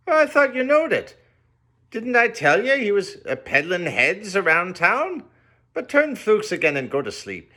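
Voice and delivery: high, loud folksy innkeeper; rustic landlord. A narrator's reading. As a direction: landlord explaining Queequeg to the guest.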